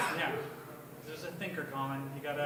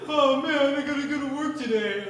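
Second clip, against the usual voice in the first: put-on kid voice; 5 semitones higher, 5 dB louder than usual. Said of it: "And he's like".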